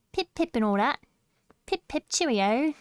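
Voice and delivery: hammed-up British with lilting rises; cutesy British. Streamer playing British for chat, cutesy mock accent. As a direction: put on a kawaii British accent. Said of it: "So kawaii and British".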